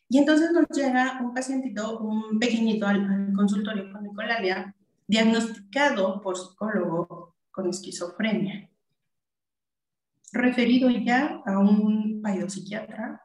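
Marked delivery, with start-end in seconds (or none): none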